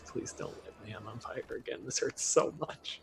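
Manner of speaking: hushed, unvoiced begging; whispery mock-agonised plea; pained pleading. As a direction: whimper a plea through pretend pain. Begging investor who burns his hand.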